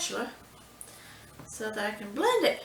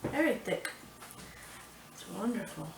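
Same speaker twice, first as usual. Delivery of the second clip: normal talking voice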